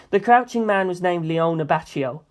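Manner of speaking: ordinary delivery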